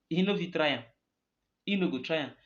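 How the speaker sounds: ordinary talk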